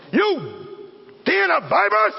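bold rebuke. Acted. Preacher replaying John's rebuke of the Pharisees, forceful. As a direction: hurl it at them with force.

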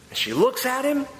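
ordinary delivery

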